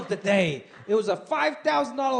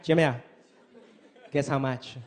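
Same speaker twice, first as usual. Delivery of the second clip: teasing mom; 9 semitones lower than usual. Retold or imitated. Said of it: "She was like".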